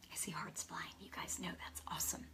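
hushed whisper to the listeners; soft secret whisper. Real, not acted. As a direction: breathe it, barely voiced. Unvoiced whisper asides.

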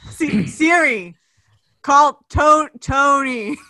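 loud, slow command. Acted. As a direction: spell it out loudly for the phone. Repeating voice command to phone.

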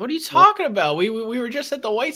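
high, loud disbelieving outburst; loud, high shocked protest; incredulous. Real, not acted. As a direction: react as if that can't be true. Friend's surprising claim.

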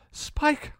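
very high, thin cry for help; alarmed cry. A narrator's reading. Trapped girl cries out for her protector, high and alarmed. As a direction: call his name high, half-voiced, from under rubble. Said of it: "he heard Dawn cry".

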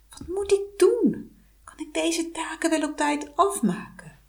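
put-on high, worried girl's voice; anxious young girl. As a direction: plead helplessly like a frightened child. Meisje wanhoopt tegen het popje. (hoog, bang meisjesstemmetje)

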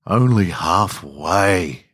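slow, weary sigh through the words. Acted. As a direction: let it out on a long, tired sigh. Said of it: "I sigh to myself".

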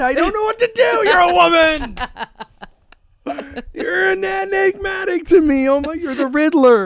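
high-pitched, hammed-up outburst; mock exasperation. Podcaster baffled by his co-host, hammed-up exasperation. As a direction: throw up a baffled, high wail as a joke.

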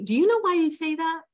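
lifted, gentle voice of God. Retold or imitated. God gently questioning her in meditation, lifted voice. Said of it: "I literally heard God's voice".

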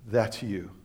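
low, soft voice of the Spirit; quiet inner voice. Retold or imitated. Holy Spirit speaks while reading.

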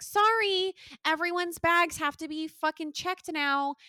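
drawn-out mock airline apology; airline staff. Airline worker breaking bad news to passengers, mock apologetic.

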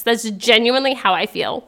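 usual speaking voice

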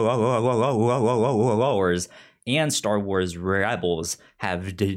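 ordinary talk

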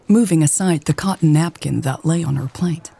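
plain reading voice

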